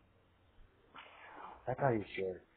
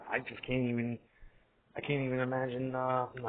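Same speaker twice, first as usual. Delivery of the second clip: usual speaking voice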